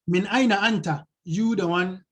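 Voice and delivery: ordinary talk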